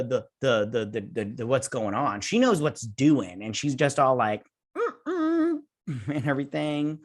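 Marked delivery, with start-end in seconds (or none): 4.8-5.6 very high, teasing closed-mouth hum; mock refusal hum. Little girl smugly refusing to warn the crew, high teasing hum. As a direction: hum a sing-song no like a bratty kid.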